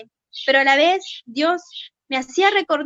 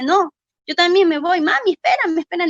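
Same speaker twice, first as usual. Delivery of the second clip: calling after mom; 5 semitones higher, 6 dB louder than usual. Retold or imitated. Calling to mother to wait.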